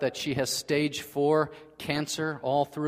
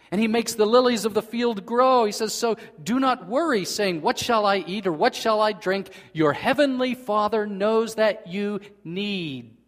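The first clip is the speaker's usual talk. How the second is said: preaching scripture; 6 semitones higher, 5 dB louder than usual. Acted. Preaching Jesus's words with force.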